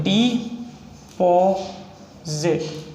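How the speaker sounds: Deposit drawn out very slowly; slow dictation. Shown as a demonstration. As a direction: stretch deposit out while writing it. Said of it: "plain take follows".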